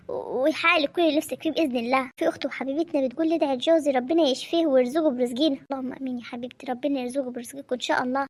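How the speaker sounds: soft, steady reading of prayer requests; dua read. Real, not acted. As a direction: read each request and bless it softly. تقرأ طلبات الدعاء بهدوء. (قراءة هادئة وحانية لطلبات الدعاء)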